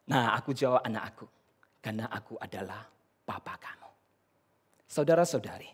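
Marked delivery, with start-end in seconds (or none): 1.8-3.9 smug quiet answer. Retold or imitated. Father answering son, punchline.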